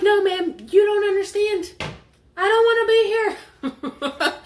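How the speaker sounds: high, loud whiny protest through laughter; laughing mock-despair. Retold self, high, loud, laughing.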